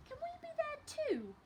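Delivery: put-on high child's plea; little girl Laura. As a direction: pipe up like a small girl asking to stay up. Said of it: "asked Laura".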